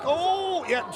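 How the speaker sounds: ordinary talk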